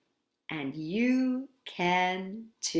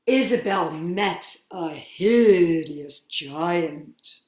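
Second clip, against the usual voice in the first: slow dramatic; 3 semitones lower, 5 dB louder, slower than usual. A narrator's reading. Dramatic slow book reading.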